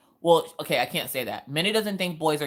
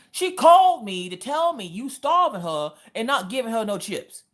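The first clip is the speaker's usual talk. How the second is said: lightly voiced grandpa; 8 semitones higher, 5 dB louder than usual. Grandpa accuses her.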